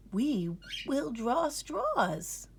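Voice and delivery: slow, even granny voice; granny calmly decides. A narrator's reading. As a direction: settle it kindly and slowly. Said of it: "said Granny Groundhog".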